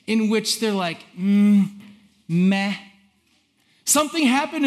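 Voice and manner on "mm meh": indifferent grunt, then a dismissive meh; mock lack of interest; unimpressed. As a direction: shrug it off with a meh. Acted crowd losing interest.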